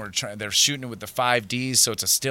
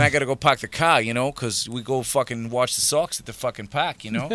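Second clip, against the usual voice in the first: put-on a light Boston accent, r's dropped on park; lightly voiced Boston accent; 2 semitones higher, 3 dB louder than usual. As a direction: play it like a Boston guy at the Sox. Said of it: "they wanted me to, uh, be Boston".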